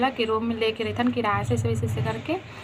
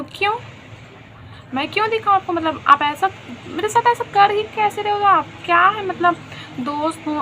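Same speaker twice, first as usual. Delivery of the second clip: retold protest, higher-pitched and mostly louder; indignant retort; 8 semitones higher, 4 dB louder than usual. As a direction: push back in a high, offended voice. Retold quarrel, indignant retort to friend. (नाराज़गी भरा ऊँचा पलटवार)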